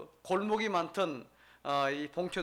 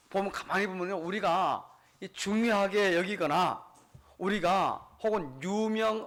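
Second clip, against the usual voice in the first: ordinary delivery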